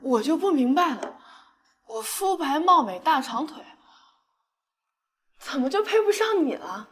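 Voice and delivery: loud tipsy pleading; drunken outburst. Acted. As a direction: yell it drunk and hurt. Drunk girl yells at prince.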